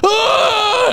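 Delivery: overacted high, wailing scream; bad actor's drawn-out cry of anguish; retold yell. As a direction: howl it, hammed-up. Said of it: "he's just yelling".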